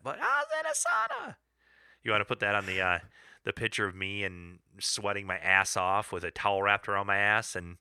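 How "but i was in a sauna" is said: very high, squeezed comic protest; mock sauna whine. Man excusing his looks in the sauna picture, silly high-pitched protest. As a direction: push it far up into a silly high voice.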